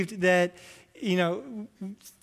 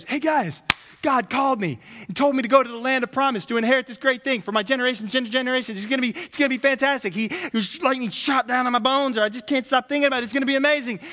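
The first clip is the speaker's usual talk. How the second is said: higher, louder hammed-up Abraham boasting to friends; excited mock Abraham; 6 semitones higher, 5 dB louder than usual. Abraham bragging to his friends, hammed-up and excited. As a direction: play Abraham bragging about God's call. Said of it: "And he goes".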